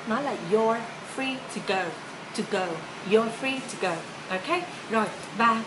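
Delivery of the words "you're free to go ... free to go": "you're free to go" run together, "you're" said like your; linked phrase. Shown as a demonstration. Teacher to learners, linking "you're free to go". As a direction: link the words smoothly. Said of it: "mình đọc những chữ này là chữ your".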